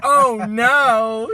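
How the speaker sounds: loud mock horror; mock horrified. Mocking everyone else's horror.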